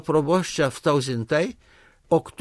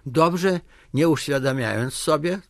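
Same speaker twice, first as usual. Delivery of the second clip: plain reading voice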